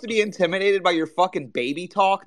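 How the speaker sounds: loud, high, incredulous retort; scornful outburst. Real, not acted. Firing back at a rival's baby-talk threat, loud and scornful. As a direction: throw it back at him, loud and scoffing.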